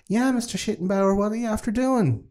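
put-on very high, small child's voice; little girl voice. Little girl behind her mother's skirt, high and timid. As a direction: pipe it up like a tiny girl hiding behind a skirt. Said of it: "little Kazelia is behind her skirt".

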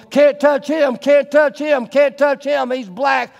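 jeering crowd at Jackie Robinson, shouted; crowd yelling. Retold or imitated. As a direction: chant it like a hostile stadium crowd. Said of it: "everybody just yelling".

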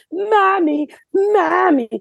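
put-on high, nagging child calling for mommy; whiny kid. Mocking the kids at the Broadway show, high whiny child. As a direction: mimic a kid pestering a parent. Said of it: "kids answering fucking questions".

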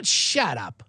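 ordinary talk, nothing marked

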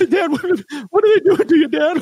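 high, whiny mock sobbing; hammed-up blubbering son. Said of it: "the blubbering ... Charlie Sheen".

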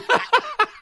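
loud, high embarrassed laugh; burst of high laughter. Real, not acted. Embarrassed memory of touch.

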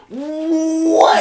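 high, loud drawn-out disbelief; incredulous shout. Real, not acted. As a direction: blurt it out, loud and stunned. Stunned by skater's four-month rise.

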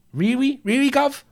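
high-pitched, hammed-up British "gov" voice; bad British accent. Streamer jokes about looking like Jason Statham, bad British accent. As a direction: put on a squeaky mock-British accent. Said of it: "That's a shit British accent".

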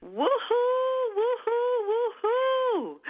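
high whooping cheer; excited radio cheer; celebratory woohoo. Real, not acted. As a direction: whoop it up for the anniversary.

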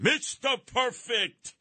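high, slow, sneering "Mister Perfect"; sarcastic title; mocking nickname. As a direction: drawl the nickname with scorn.

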